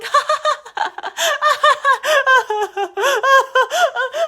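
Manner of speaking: long, high imitated cackle; mock laughing fit. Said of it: "he was just laughing".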